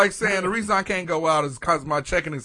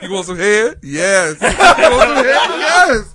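mock seductive killer; 8 dB louder than usual. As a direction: ham up a cartoon killer's taunt. Voicing the killer, loud and laughing.

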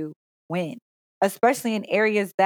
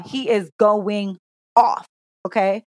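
normal talking voice

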